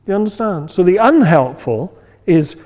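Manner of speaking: ordinary talk, nothing marked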